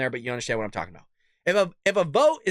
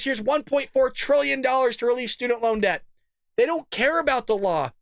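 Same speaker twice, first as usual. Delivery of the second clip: ordinary talk, nothing marked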